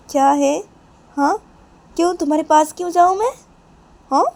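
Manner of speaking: loud, high, snappish questions; irritated refusal. Acted. Mother refuses to sit near Manisha, irritated. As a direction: throw the invitation back crossly.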